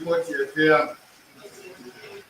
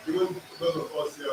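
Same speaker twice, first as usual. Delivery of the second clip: ordinary talk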